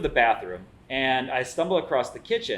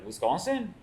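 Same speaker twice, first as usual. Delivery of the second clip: higher, puzzled French chef repeating Wisconsin?; 8 semitones higher than usual. Retold or imitated. French chef baffled by a Wisconsin question, higher. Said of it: "it's a French guy".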